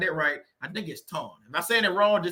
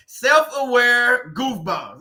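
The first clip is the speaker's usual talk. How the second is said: drawn out, savoring; 2 semitones higher, 4 dB louder, much slower than usual. Shown as a demonstration. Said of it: "That's a good one".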